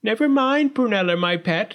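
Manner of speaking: high, loud doting parent voice; fussy storybook mother; consoling mother. A narrator's reading. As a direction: console your pet in a high voice. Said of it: "said her pin-headed but practical parent".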